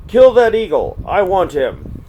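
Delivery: loud, high order to kill; commanding shout. Acted. Curlew to his family, a high, loud command. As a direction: bark the order at the whole family.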